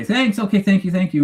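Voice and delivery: high-pitched, hurried-over string of thanks; acted polite thanks. Customer thanking the gas station guy for directions, acted high-pitched politeness.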